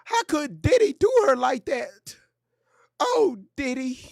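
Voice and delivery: high, gasping mock outrage over the gossip; mock gasping viewer. Mocking commenters who believe the story, high gasps. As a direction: squeal like a shocked gossip fan. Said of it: "mocking gullible viewers".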